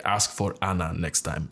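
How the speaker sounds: slow, mock Russian accent; thick Russian accent. Hairdresser sending client off, thick Russian accent. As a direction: instruct him slowly in a Russian accent. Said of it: "very thick Russian accent".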